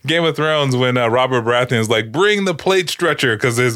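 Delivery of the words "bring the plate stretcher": mock royal command. Quoting Robert Baratheon's bellowed order.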